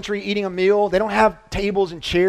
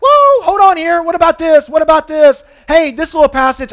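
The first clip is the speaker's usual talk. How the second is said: higher and louder, alarmed "whoa, hold on"; mock alarmed objection; 6 semitones higher, 4 dB louder than usual. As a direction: play a scandalized religious leader raising objections. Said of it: "would be going like".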